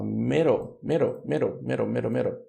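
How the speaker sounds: "middle" with the d tapped and a dark L ending; model pronunciation. Shown as a demonstration. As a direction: flap the d in "middle". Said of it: "vamos ler então".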